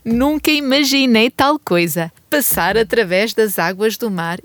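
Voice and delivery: ordinary delivery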